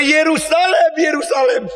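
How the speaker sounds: high, loud anguished call of Yerusalem; Jesus crying out. Acted. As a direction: call out to the city in grief. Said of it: "Yesus Kristus berkata". (seruan Yesus yang keras dan pilu)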